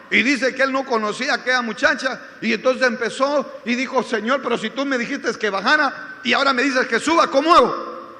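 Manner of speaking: loud, pressing sermon storytelling; impassioned preaching narration. Real, not acted. (relato predicado con voz fuerte)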